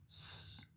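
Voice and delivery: long sustained hiss for "S"; pure held sss on "S"; isolated S sound. Shown as a demonstration. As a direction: say "S" as a drawn-out sss, not the letter name. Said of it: "tu entends le son S".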